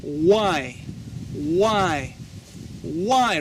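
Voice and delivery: slowly modelled WHY with a smooth W glide; teacher's careful WHY; correct pronunciation. Shown as a demonstration. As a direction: say WHY as "wai", drawn out for learners.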